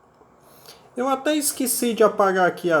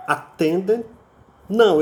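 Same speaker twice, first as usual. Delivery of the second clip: ordinary talk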